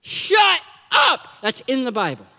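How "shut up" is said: high, drawn-out "shut up"; retold blunt shout. Preacher tells a crowd as Moses, retold blunt shout. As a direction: shout it as a punchline command. Sermon retelling Moses ending his speech.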